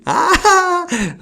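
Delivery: high shriek breaking into laughter; laughing shriek. Real, not acted. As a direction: squeal and laugh at once. Laughing shriek after scare.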